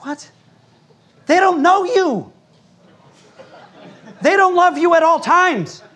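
incredulous. Retold or imitated. Pastor mocks a crying man's loyalty, high and incredulous. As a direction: scoff at the tears in disbelief. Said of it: "It's just like".

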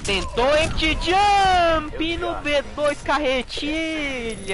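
drawn-out, rising play-by-play call; excited hype call. Real, not acted. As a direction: stretch it out like a big esports moment. Caster hyping a big play.

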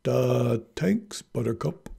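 slow mock dullard, dropped th; dim-witted Wesley. Narrator imagines Wesley thanking Buttercup, slow and dim-witted. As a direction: drag it out like a dimwit. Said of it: "kind of thing Wesley came out with".

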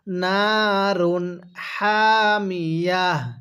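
নারুন হামিয়া drawn out slowly; teacher model reading, very slow; slow recitation demo. Shown as a demonstration. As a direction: stop on হামিয়া with a held ending. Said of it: "পড়ুন আমার সাথে".

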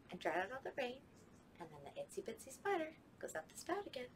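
ordinary talk, nothing marked

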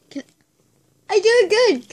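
usual speaking voice

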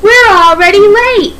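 loud, high impatient complaint; crying out. Acted. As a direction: call out loudly over the arguing. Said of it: "cried the passengers".

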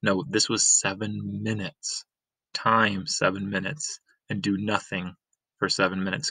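plain reading voice

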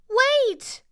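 high, loud call after someone; crying out. A narrator's reading. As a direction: cry out as a little girl giving chase. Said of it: "the little girl cried".

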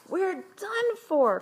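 high, loud, drawn-out panic; despairing cry. A narrator's reading. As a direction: wail like a doomed kid. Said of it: "cried Joe".

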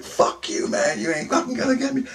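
high, strained defiance without much volume; high-pitched retold yelling; mock yell. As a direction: act out cursing off an attacker, held back. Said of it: "I'm yelling".

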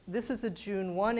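ordinary talk, nothing marked

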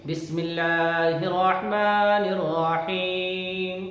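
slow, melodic tajweed chant; measured, sustained recitation on a steady note; Quran recitation. Shown as a demonstration. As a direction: chant it with long, drawn-out vowels. Quran recitation for pupils to follow.

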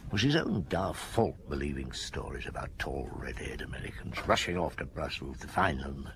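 low, half-whispered musing to himself; quiet rueful inner monologue; hushed inner thoughts. Acted. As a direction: murmur it like thoughts no one else should hear. Detective's private reflective aside.

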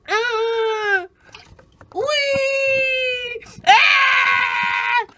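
hammed-up whee shrieks; gleeful shrieks. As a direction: squeal down the slide. Sliding down tear slide.

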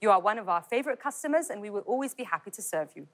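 slow, polite British waiter voice; British accent. Shown as a demonstration. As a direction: serve it with British courtesy. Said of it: "دي مثلًا لو British". (لهجة بريطانية مهذبة وبطيئة)

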